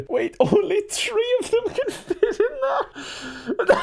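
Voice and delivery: helpless high-pitched laughter through the words; barely getting the words out for laughing; laughing hard. Real, not acted. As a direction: squeal it out slowly, cracking up. Cracking up at cows turning back.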